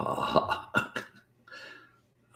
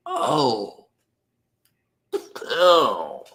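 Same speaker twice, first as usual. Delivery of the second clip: high, loud "Oh" of disgust; disgusted cry; 10 semitones higher, 6 dB louder than usual. Real, not acted. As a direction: recoil at the thought. Disgust at kangaroo.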